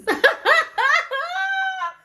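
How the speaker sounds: loud, high burst of laughter rising into a squeal. Real, not acted. Vlogger retelling the tenant news, laughing uncontrollably.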